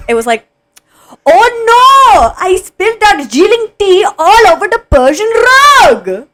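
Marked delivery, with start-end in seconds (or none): 1.3-6.3 very high, loud, hammed-up Indian accent; theatrical wail in a put-on Indian accent, drawn out. Shown as a demonstration. Friend redoing her high school play line, Indian accent. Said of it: "can you do a line from the play".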